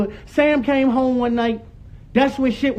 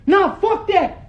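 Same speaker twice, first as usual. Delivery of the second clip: higher, drawn-out angry refusal; heated refusal; 5 semitones higher, slower than usual. Real, not acted. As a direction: refuse to be hushed. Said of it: "Stop yelling".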